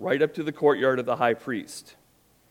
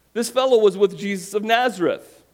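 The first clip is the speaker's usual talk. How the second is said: higher, louder call-out accusing him; pointing servant girl; 9 semitones higher, 6 dB louder than usual. Acted. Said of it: "said to the people".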